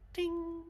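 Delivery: small high chime sound; tiny ding. Retold or imitated. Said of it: "a teeny tiny little".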